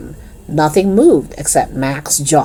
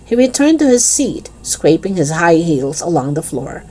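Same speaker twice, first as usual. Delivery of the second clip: ordinary delivery, nothing marked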